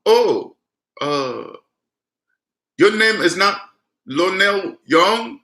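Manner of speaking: high, slow, halting mock Indian accent; puzzled question in a put-on Indian accent; imitated Indian accent. Scammer doubting the caller's name, mock Indian accent. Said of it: "you hear that Indian voice".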